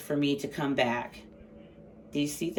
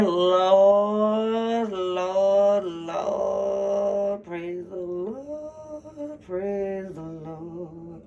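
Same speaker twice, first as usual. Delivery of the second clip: drawn-out praise; much slower than usual. Real, not acted. Tarot reader marvels at the cards, slow drawn-out praise. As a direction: draw each word out slowly in awe.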